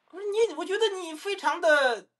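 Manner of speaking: high, slowed, drawn-out reproach; exasperated slow high complaint; indignant accusation. Real, not acted. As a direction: stretch it out in high-pitched disbelief. Heated livestream argument with caller.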